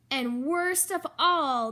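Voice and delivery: plain reading voice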